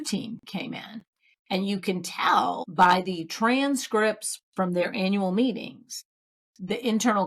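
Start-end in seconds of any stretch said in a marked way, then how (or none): none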